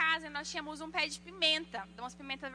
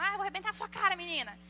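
higher-pitched scolding imitation; mock parental threat; 4 semitones higher, faster than usual. Parent threatening a girl in public, mock angry. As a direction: act out an angry parent, half in jest.

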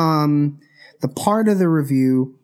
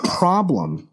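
coughing fit. Real, not acted. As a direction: cough breaking into the words. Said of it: "I'm coughing a lot".

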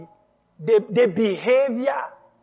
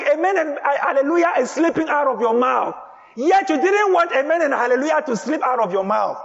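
raised, fast, pushing preacher's voice; full-force sermon delivery; fiery preaching; 4 semitones higher than usual. Acted. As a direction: preach it hard to the congregation. Preacher drives the point home.

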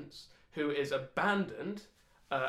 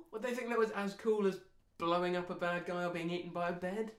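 normal talking voice

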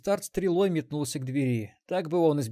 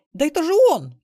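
loud, high frantic shriek; desperate squeal; 12 dB louder than usual. A narrator's reading. Granddaughter recognising the robber, desperate high squeal. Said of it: "вдруг отчаянно заверещала внучка".

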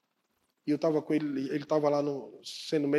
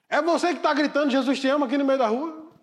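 confronting; 7 semitones higher, 5 dB louder than usual. Acted. Armed man confronting shouter.

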